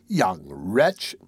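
low-pitched, disapproving woman; lightly voiced scold, pitched low; scolding onlooker. A narrator's reading. Woman onlooker condemns the boy.

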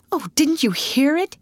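high, alarmed gasp of a question. A narrator's reading. Ruth frightened by a cry in the night, gasped. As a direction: gasp it out, pitched well up. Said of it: "gasped Ruth".

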